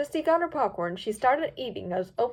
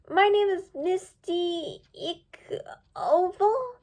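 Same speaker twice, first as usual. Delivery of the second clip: slow unsure whisper; whispered fumble; nervous slip; 8 semitones higher, much slower than usual. A narrator's reading.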